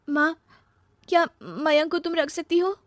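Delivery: high, plaintive daughter's voice; high-pitched anxious appeal; pleading. Acted. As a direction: ask your mother for help. Daughter begs mother by phone.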